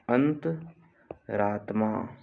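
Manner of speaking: "अंतरात्मा" slow, pause after अंत; split slowly for dictation. Shown as a demonstration. Teacher dictating a spelling, अंतरात्मा slowly in two parts.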